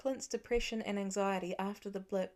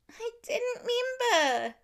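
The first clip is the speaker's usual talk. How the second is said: much higher, louder, slow frustrated cry; frustrated child outburst; 7 dB louder than usual. A narrator's reading. Nate furious that nobody believes him, loud high outburst. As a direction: burst out like a fed-up little kid. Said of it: "Nate clenched his fists".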